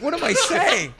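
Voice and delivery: high, loud yelp of disbelief; comic panicked inner cry; horrified at himself. Retold or imitated. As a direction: squeal it in self-horror. Horrified at his own babbling.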